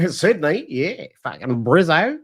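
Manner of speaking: put-on laughing Aussie accent, drawn out on "Brizzo"; exaggerated Australian vowels, stretched and rising; mock Australian. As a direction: echo it in a hammed-up Australian accent, slowing down. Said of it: "going to Australia".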